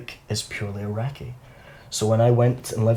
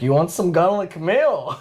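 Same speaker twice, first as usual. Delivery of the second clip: slow, higher, leering offer; 5 semitones higher, slower than usual. Retold or imitated. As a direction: drag it out like a creepy offer. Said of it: "he's like".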